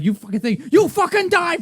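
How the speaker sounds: high, loud movie-gangster yell; imitated furious yell. Acting out the car scene for a co-host, furious yell. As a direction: explode as the gangster pulling the trigger.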